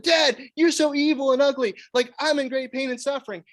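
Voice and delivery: put-on high, loud whiny kid voice; wailing child. Daughter stung by fire ants whining at her father, high loud whine. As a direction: whine at Dad like a hurt little girl. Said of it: "they're screaming and crying".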